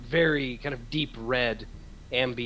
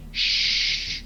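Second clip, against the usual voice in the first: sharp unvoiced shush; 4 dB louder than usual. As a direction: hush them with a hiss. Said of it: "both heads look and go".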